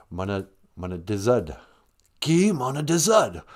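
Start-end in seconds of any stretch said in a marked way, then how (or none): 2.2-3.4 put-on singsong Rez-accented Ojibwe. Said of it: "don't say it with that Rez accent".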